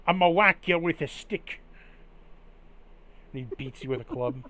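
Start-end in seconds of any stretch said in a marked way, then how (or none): 0.1-1.6 louder, higher threat with a stick; mock threat. Villager threatens the player's character, louder mock menace. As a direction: bark the threat like a cranky villager.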